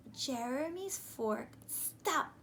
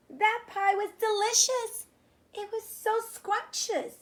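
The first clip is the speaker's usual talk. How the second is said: higher, louder happy wonder; delighted; 9 semitones higher, 8 dB louder than usual. Acted. Boy discovering the enemy pie tastes good. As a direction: savour the surprise.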